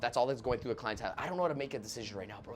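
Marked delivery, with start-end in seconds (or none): 1.2-2.6 quick, soft worried mutter; anxious client. Retold or imitated. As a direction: rush it like an anxious buyer. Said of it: "That's what he's thinking".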